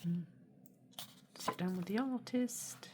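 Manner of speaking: usual speaking voice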